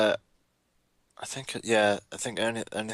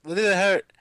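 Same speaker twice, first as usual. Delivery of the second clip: scout voice; 9 semitones higher, 6 dB louder than usual. Pretend. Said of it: "you know how the scouts".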